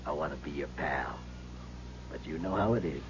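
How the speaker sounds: soft, low threat; menacing quiet. Acted. Racketeer threatening Louis, soft and menacing. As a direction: lean in and menace him softly.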